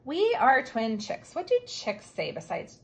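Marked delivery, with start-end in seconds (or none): 0.1-1.3 slow, word by word reading aloud; careful pointing read-aloud. Shown as a demonstration. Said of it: "T says t".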